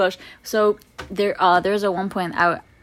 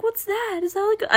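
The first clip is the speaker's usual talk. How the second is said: high puzzled questions; high and baffled. Retold or imitated. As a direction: ask like a confused kid. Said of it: "I'm like".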